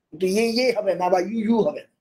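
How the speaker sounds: normal talking voice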